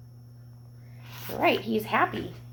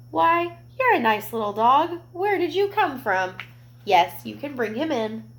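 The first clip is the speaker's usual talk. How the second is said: higher, friendly welcoming lady; kind lady; 5 semitones higher, 4 dB louder than usual. Acted. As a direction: greet the lost boy kindly. Lady voiced in story.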